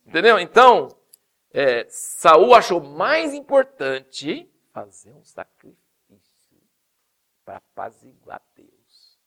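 ordinary delivery

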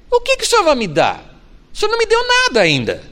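high, fast exasperated plea. Acted. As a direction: push the complaint up high and quick. Abraham's words quoted. (Abraão exasperado, voz muito aguda)

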